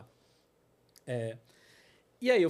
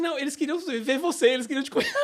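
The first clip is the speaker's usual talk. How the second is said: a touch of laughing excitement, a bit higher; excited retelling; 8 semitones higher, 5 dB louder than usual. Retold or imitated. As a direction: pass on the thrilling news, half laughing. Retells woman's joyful news. (contando a novidade animado, quase rindo)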